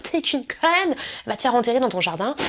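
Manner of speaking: high-pitched mock outburst; mock hater. Hater leaving a nasty comment, mock fury. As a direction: spit out the insult like a ranting commenter. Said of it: "mettre directement un commentaire".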